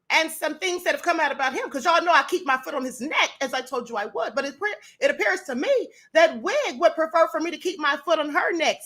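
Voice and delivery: normal talking voice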